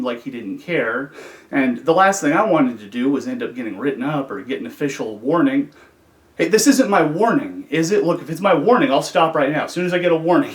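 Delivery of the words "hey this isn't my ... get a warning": higher, louder bargaining; anxious pleading. Retold or imitated. Inmate asking officer if this is a warning, pleading. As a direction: talk your way out of it, voice raised.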